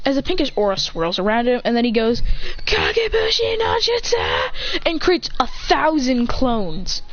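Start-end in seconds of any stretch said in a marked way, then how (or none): 2.7-4.5 high anime attack call; battle cry. Acted. Fighter creates a thousand clones, high battle cry. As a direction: shout the move's name high, in full anime style.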